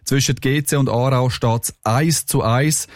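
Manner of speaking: normal talking voice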